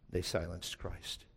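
solemn hush to the congregation; hushed solemn refrain. Real, not acted. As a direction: drop it low, soft and slow.

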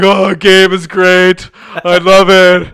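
loud, high, drawn-out gushing; mock dumbstruck fan. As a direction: blurt it like a starstruck oaf. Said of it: "I felt like a Neanderthal".

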